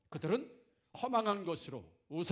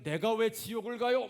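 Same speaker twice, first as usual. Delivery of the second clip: higher and louder offended comeback; indignant retort; 5 semitones higher, 5 dB louder than usual. Retold or imitated. Unbeliever told he'll go to hell, firing back indignant. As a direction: snap back, insulted at the warning.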